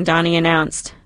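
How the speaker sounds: usual speaking voice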